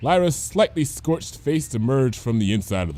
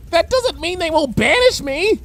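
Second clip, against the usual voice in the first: high, louder worried pony voice; anxious wail; 5 dB louder than usual. Acted. Bon Bon fretting to Lyra about being banished. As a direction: fret out loud.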